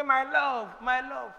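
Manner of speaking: affectionate wife. Retold or imitated. A modern wife sweetly calling her husband, tender. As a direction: coo it to your husband.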